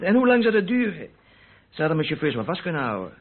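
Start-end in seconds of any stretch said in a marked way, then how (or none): 0.0-1.1 much higher, louder protest; indignant outburst. Acted. Suspect snaps back at the inspector, much higher and louder.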